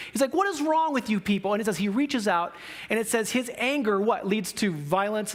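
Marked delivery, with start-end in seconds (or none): 0.3-1.5 higher-pitched, exasperated demand; angry reproach. Acted. Preacher voices Jesus angry at the people, exasperated. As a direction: take them to task in acted anger. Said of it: "It's like he's angry".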